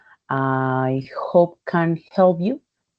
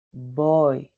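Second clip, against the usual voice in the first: ordinary delivery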